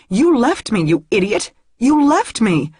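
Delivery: high, loud furious cry; loud, high accusation, slapping his chest; angry shout. Acted. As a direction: yell it in his face, rising.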